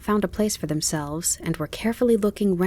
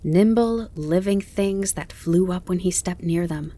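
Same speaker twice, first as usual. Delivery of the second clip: ordinary delivery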